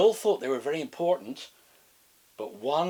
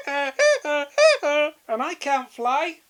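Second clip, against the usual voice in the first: high, very slow, loud penguin lament; jackass penguin; 10 dB louder, much slower than usual. Acted. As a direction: drag it out as a comic penguin. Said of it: "said another one".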